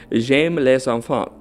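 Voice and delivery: "J'aime les enfants" said slowly in French; French pronunciation. Shown as a demonstration. Host reading the French phrase aloud, slow French. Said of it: "the way to say I love children in French is".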